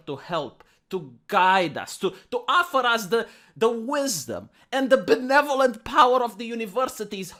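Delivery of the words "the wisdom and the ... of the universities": ordinary talk, nothing marked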